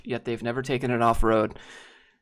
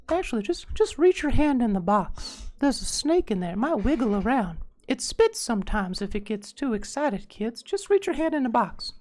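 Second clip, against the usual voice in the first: put-on creepy kids magician; 10 semitones higher than usual. Streamer pretending to lure kids into a trick, high magician voice. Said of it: "because I'd be like".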